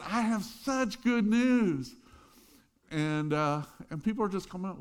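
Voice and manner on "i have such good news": high, loud and slow, beaming; a student calling to the crowd, loud and happy; joyful shout. Retold or imitated. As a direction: call it out to passers-by with a big smile. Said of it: "the top of his lungs".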